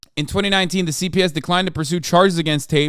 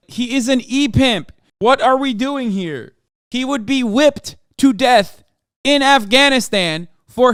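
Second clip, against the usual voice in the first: exasperated rant; 7 semitones higher, 5 dB louder than usual. Real, not acted. Ranting about Tate and the Taliban.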